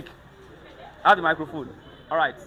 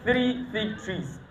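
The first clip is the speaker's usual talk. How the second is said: slow and soft, word by word; slow tongue twister demo; 6 dB quieter, much slower than usual. Shown as a demonstration. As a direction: model it slowly for the student. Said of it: "Are you ready?".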